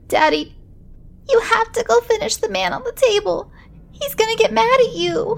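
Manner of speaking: put-on high, desperate child voice; a panicked kid pleading; frantic little boy. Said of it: "burst into hysterics".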